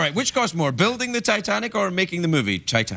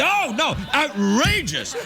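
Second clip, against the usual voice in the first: outraged ladies; 9 semitones higher, slower than usual. Retold or imitated. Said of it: "They were like".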